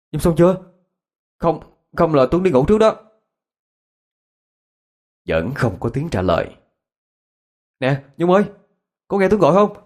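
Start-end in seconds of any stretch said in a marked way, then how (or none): 0.1-3.0 raised, higher-pitched worried call; urgent call, pitched up; calling through a door. Acted. 7.8-9.8 high, urgent call through a door; anxious raised voice; worried calling out. Acted. Calling friend who doesn't answer.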